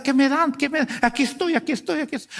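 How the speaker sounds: high-pitched, repeated eager pleading; mock eager receivers. Preacher mocking churchgoers who come for handouts, mock eager. Mimics people waiting for handouts.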